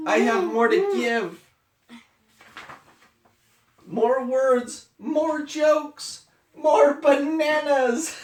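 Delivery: high, loud, slowing plea; pleading. Acted.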